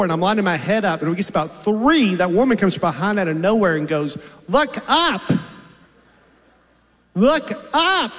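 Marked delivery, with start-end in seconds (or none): 4.5-8.2 higher, pushy clerk repeating an order; mock DMV clerk insisting on look up; clerk's order. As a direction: imitate the impatient clerk. Clerk barks order from behind.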